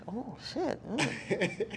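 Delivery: hushed, dawning admission; mock-rueful quiet aside; acted sheepish realization. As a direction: let it drop softly as it sinks in. Acting out her sudden realization.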